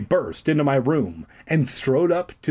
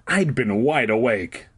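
usual speaking voice

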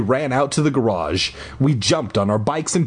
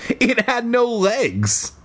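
high, drawn-out insistence; telling oneself; 11 semitones higher, slower than usual. A narrator's reading. Kid reassuring himself about the escaped creature, high insistence. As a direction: stretch it out high, convincing yourself. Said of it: "I told myself".

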